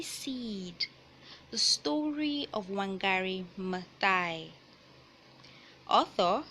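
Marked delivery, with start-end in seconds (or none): none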